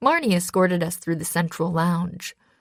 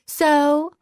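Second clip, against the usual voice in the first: put-on lilting spoiled-girl voice, higher; sing-song like a spoiled daughter; bratty daughter lilt; 9 semitones higher, 5 dB louder than usual. A narrator's reading. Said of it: "I added an extra lilt to my voice".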